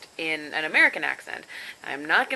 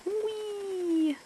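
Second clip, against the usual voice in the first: playful whee; 13 semitones higher, 4 dB quieter than usual. Real, not acted. Drawing a line in the app.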